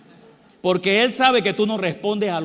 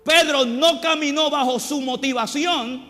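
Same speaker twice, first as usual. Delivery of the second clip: louder, higher driving sermon delivery; fiery preaching; 4 semitones higher, 3 dB louder than usual. Acted. A pastor exhorting the church about obeying God's word, fiery preaching. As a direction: preach it with rising force.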